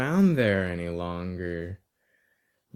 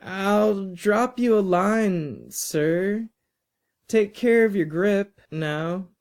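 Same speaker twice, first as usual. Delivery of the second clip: put-on polite departing boy; 9 semitones higher than usual. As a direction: sign off to sir, pitched up and courteous. Boy says farewell to teacher.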